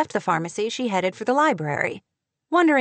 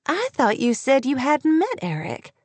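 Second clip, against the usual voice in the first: higher-pitched, puzzled query; mildly baffled, pitched up; 5 semitones higher than usual. A narrator's reading. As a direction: lift the voice in confusion. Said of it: "clearly puzzled".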